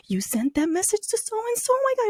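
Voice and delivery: high-pitched retold dismay; scandalized, high mock horror; horrified friend.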